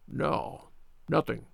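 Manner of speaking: very soft, near-whispered reply; feeble. Acted. Sick man answering an interrogator, feeble. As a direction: let it out faintly, barely voiced.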